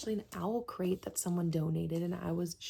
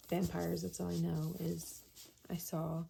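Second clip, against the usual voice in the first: normal talking voice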